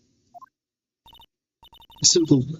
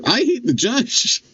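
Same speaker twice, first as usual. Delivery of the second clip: 1 exasperated; 11 semitones higher, slower than usual. Real, not acted. Exasperated at judge.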